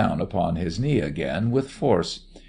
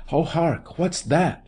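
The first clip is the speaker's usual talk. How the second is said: high, startled question; 8 semitones higher than usual. A narrator's reading. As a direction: pitch it right up, as if startled. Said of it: "whispered in a startled voice".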